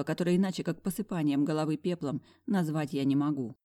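ordinary delivery, nothing marked

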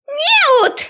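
очень высокий визг преподавательницы; взвизгни не своим голосом; истошный визг. A narrator's reading. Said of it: "завизжала Грэдис не своим голосом". (визгливо, в истерике)

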